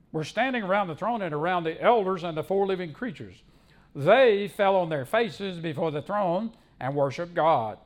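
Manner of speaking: loud, emphatic Bible reading; forceful pulpit reading; scripture proclamation. Acted. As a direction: declaim the verse with weight on key words. Preacher reads scripture aloud.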